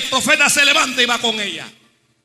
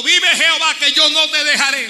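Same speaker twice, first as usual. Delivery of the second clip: higher, louder vow; fervent vow; 6 semitones higher, 7 dB louder than usual. Acted. Mother vowing to the prophet, higher and louder. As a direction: swear it with everything. Woman's vow voiced forcefully.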